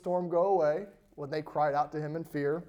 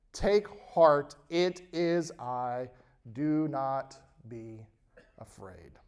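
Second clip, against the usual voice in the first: soft, slow and calm; gently calm frightened friends; reassuring Jesus; 4 semitones lower, 4 dB quieter, slower than usual. Acted.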